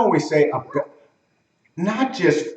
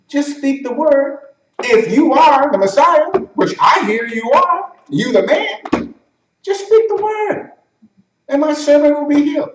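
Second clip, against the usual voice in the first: fervent centurion; 9 semitones higher than usual. Acted. Centurion telling Jesus to heal his servant, fervent.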